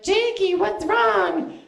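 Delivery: very high, squeaky mock concern; high little twink voice. Comic imitating young twinks asking him what's wrong, high little voices. Voicing the small twinks in chorus.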